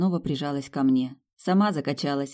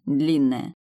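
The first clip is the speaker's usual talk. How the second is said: ordinary delivery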